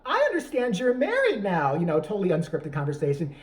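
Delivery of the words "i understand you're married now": hammed-up interviewer, louder and higher; big, slow stagey interviewer voice; mock talk-show host. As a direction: play the chatty host setting up a question. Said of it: "he sits there and he's like".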